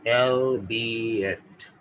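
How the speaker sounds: albeit stretched and falling, "elbieet"; teacher repeating albeit slowly; drawn-out model word. Shown as a demonstration. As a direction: draw out albeit so they hear it. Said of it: "सुनाई दिया ना आपको?".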